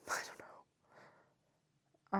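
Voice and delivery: drawn-out voiceless whisper; slow whisper. Real, not acted. Shrugging aside to viewers, whispered slowly. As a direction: breathe it out, amused. Laughing aside about freestyling.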